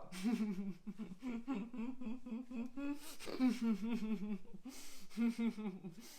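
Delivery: laughing through closed lips; closed-mouth laughter. Shown as a demonstration. Teacher showing the class: laughter with mouth closed. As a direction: hold the lips shut and laugh anyway. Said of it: "Laughter with our mouth closed".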